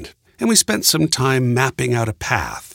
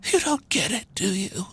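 high, feeble woman's gasp; weak gasp; 9 semitones higher, 5 dB quieter than usual. A narrator's reading. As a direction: gasp it out faintly, high-pitched. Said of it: "the weak woman gasped".

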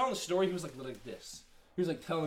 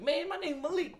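"Malik" pitched way up, mocking; mock other kid; 13 semitones higher, slower than usual. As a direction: imitate him with a high, whiny pitch. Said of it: "He was like".

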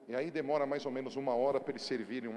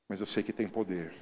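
ordinary talk

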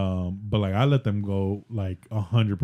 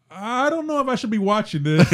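imitating daughter; 10 semitones higher than usual. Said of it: "she's like".

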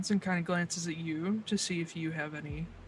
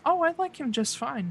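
Liz's voice; 7 semitones higher, faster than usual. Acted. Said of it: "She says".

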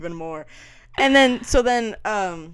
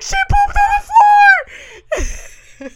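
high, drawn-out mock scream; horrified yell; 11 dB louder, much slower than usual. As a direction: shriek the awful news to mom. Horrified answer to mom, reenacted.

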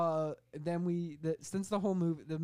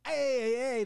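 loud, high hollered heys; mock hey-hey shout; 7 semitones higher, 7 dB louder than usual. Movie hero in the trailer, hollered loud. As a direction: call it out big and high. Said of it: "where he's like".

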